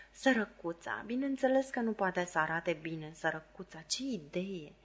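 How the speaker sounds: soft, sinking murmur of mock pity. A narrator's reading. Camila pitying Miss Havisham, murmured softly. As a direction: murmur it under your breath, falsely sorry. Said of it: "Murmură în vreme ce o privea cu milă".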